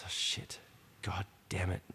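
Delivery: hushed, breathless oh shit under her breath; scared whisper. Retold or imitated. Woman eyeing a staring gang girl, frightened whisper. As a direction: whisper it so the gang can't hear. Panicked thought on the train.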